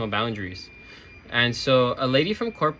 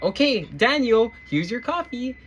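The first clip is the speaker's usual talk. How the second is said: mock barista sweetness; 8 semitones higher than usual. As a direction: hand it over like a cheery cashier. Lady acting barista in role play.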